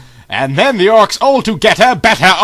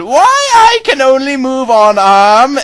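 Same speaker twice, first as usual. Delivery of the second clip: hurt Lorna; 8 semitones higher, 4 dB louder, slower than usual. Acted. Injured Lorna wakes confused.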